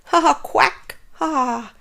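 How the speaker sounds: high, loud quacking laughter; mocking duck laugh. Duck jeering at Shelley, quacking laughter. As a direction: laugh at her like a smug duck.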